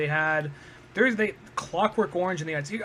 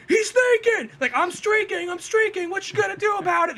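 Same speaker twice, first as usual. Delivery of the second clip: high, loud cartoon taunt; mock gleeful shouting; 13 semitones higher, 4 dB louder than usual. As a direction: imitate a cartoon troublemaker yelling in defiance. Acts cartoon duck streaking, taunting.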